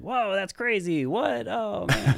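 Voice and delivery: high, slow mock amazement; hyped radio sidekick. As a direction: gush like a wowed radio co-host. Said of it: "I was just there to be like".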